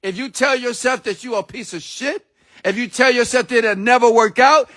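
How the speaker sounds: ordinary talk, nothing marked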